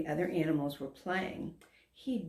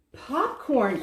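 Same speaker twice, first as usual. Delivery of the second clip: loud, higher delighted cry; cried out; 7 semitones higher, 5 dB louder than usual. A narrator's reading. Hungry dragon waking up, crying out with joy. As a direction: shout it like you just found treasure. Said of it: "cried Dexter".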